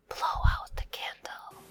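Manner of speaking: whispered repeat, no voice. Shown as a demonstration. Teacher whispering the phrase back for kids. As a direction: hush the line like blowing a candle.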